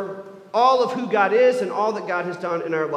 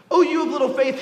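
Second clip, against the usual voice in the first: proclaiming; 5 semitones higher, 5 dB louder, faster than usual. Acted. Repeated call in sermon.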